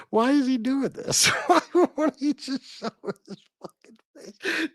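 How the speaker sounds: high, helpless laughter through the words; cracking up. Real, not acted. Streamer baffled by a host appearing as a puppet, cracking up. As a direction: ask it while falling apart laughing.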